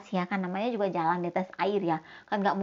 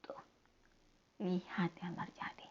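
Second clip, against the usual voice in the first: whisper; 3 semitones lower, 15 dB quieter than usual. Real, not acted. Teacher whispering to the children to look. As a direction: draw them in with a secret whisper.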